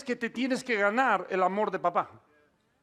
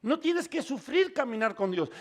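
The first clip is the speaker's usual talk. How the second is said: ordinary talk, nothing marked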